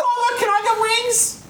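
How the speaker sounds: put-on very high pleading kid, laughing; little boy begging the priest; child voice. Altar boy voice.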